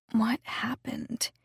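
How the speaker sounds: slow, groggy whisper. Acted. Zoe groggy in her hospital bed, slow whispered question. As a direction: murmur it half awake. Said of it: "said Zoe groggily".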